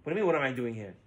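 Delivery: reply quietly, thrown by the shout; shocked reply. Acted. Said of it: "His son-in-law is shocked".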